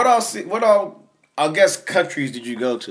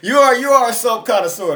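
higher, louder, laughing tease; teasing laugh; 8 semitones higher, 5 dB louder than usual. Real, not acted. Teasing his friend about loving soap, laughing. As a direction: laugh while teasing him.

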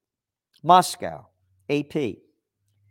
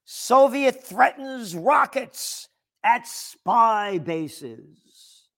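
dramatic headline read; 7 semitones higher, much slower than usual. Shown as a demonstration. Reading an old headline.

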